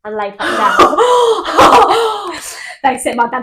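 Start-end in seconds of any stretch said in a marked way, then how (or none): 1.0-2.8 loud laugh. Real, not acted. Laughing at her story.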